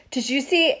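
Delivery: ordinary talk, nothing marked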